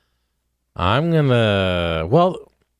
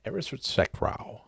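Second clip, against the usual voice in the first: "Erisretsekrau" said again much softer; soft repeat; 3 semitones higher, 12 dB quieter than usual. Shown as a demonstration. Cohost repeats the backwards word softly. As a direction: quietly repeat "Erisretsekrau" for emphasis. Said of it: "So I'm gonna say it's".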